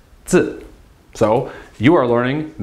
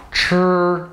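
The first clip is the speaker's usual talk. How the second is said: ordinary talk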